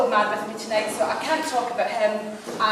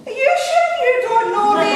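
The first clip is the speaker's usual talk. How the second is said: very high, loud Coatbridge screech; Coatbridge accent; 8 dB louder than usual. Retold or imitated. Caller quizzing the receptionist, loud high Coatbridge accent. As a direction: imitate a Coatbridge caller at full pitch. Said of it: "in her best Coatbridge accent".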